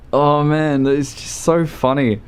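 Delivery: normal talking voice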